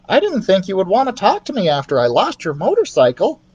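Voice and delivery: guilty little mouse apologising; lightly voiced sheepish mouse. Ralph voiced.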